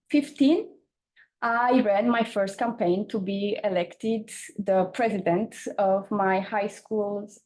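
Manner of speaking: normal talking voice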